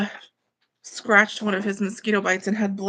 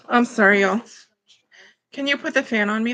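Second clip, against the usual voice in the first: usual speaking voice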